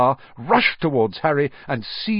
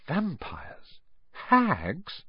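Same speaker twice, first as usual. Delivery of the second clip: stunned thought; 6 semitones higher, 6 dB quieter, much slower than usual. Acted.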